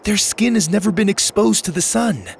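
put-on high, urgent girl character; worried girl. Girl explains friends' pain urgently.

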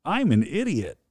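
high, swooping put-on voice; put-on catchphrase. Husband repeats his silly line on request, put-on high voice. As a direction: say it as a high sing-song catchphrase. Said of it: "wanna say that thing one more time".

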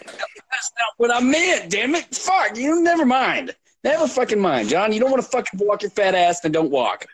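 high, slow put-on rant; mock angry voice. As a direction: whine it in a squeaky voice.

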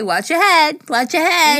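high, loud repeated warning; mock warning call. Warning friends about the cabinet, high and loud. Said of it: "I always say".

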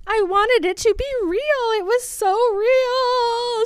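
high wail, dragged out at the end; hammed-up tearful keening; mock screaming and sobbing. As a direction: wail it in overblown heartbreak. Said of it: "She's screaming and sobbing".

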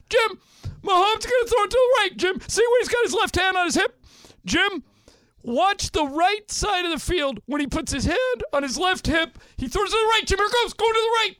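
very high, loud, excitable commentator voice; loud, squealing sportscaster send-up; Tony Romo impression. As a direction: send the voice way up and yelp the play prediction. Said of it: "his voice goes up".

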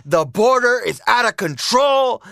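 mock Trump rally soundbite; Trump-style rally line. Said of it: "when he should really be talking about".

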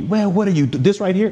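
mocking coach. Coach ridiculing players on tape.

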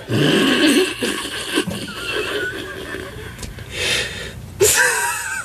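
high helpless giggling fit; can't stop laughing; hysterical laugh. Real, not acted. Laughing at tragic news.

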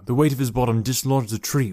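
ordinary delivery, nothing marked

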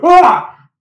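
short, high, loud "ah"; mock attack cry; imitated charging yell. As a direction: yell out as you lunge in. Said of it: "rushes the outside and goes".

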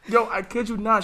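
ordinary talk, nothing marked

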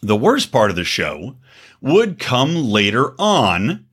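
usual speaking voice

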